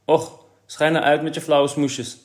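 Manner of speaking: irritated. A narrator's reading. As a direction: brush him off crossly, slowly. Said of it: "toen Kees hem nijdig voorbij liep met de woorden".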